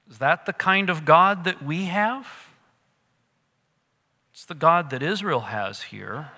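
ordinary delivery

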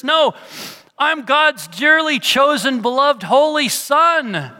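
high, loud, exultant proclaiming; joyful declaration. Pretend. Preacher proclaiming to church, exultant and loud. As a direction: ring out the believer's joy.

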